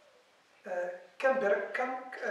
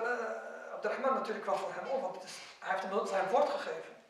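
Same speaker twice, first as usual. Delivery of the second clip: normal talking voice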